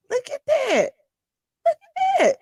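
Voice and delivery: very high squealed "Look at that"; excited gawking. Real, not acted. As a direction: squeal with delight at the view. Gawking at mansion photos.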